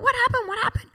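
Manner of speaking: high-pitched urgent questions; alarmed mother. Retold or imitated. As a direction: act out a panicked parent. Mother answering screaming child.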